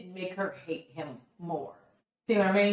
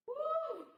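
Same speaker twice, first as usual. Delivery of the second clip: soft, high-pitched whoop; high "woo"; 7 dB quieter than usual. Real, not acted. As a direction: let out a long, airy "woo". Exclaims over the episode.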